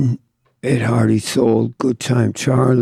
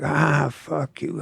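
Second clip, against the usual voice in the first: higher, softer, drawn-out retort; mock drunken rant; 5 semitones higher, 5 dB quieter, slower than usual. Wino cutting off the tattooer, imitated rant. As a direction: imitate a wino brushing someone off, slowly. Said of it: "he starts fucking ranting and raving".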